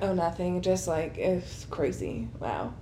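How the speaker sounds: fishing for attention, trailing off; coy brush-off. Retold or imitated. Imitating attention seeker brushing off.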